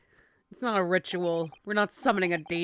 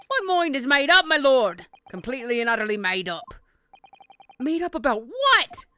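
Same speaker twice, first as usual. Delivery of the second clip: stubborn juror in shaky-attempt Cockney; amateur Cockney game-character voice; 5 semitones higher, 7 dB louder than usual. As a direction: try a Cockney accent, firmly opinionated. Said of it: "I can do at least a Cockney".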